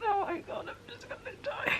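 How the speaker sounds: high, hushed tearful whimper; crying. Real, not acted. Reader overwhelmed by the chapter, crying. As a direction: whimper it very softly, overcome. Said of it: "I'm crying".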